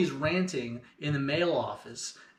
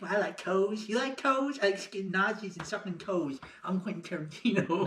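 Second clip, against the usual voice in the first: rambling celebrity impression, pitch jumping up; jabbering film-director caricature; Quentin Tarantino; 3 semitones higher, 4 dB quieter than usual. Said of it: "like, doing a Quentin Tarantino".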